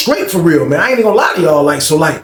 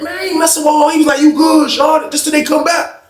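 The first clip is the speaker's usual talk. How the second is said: high retold shout; 12 semitones higher than usual. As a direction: yell across the room to convince him. Voicing both sides of exchange.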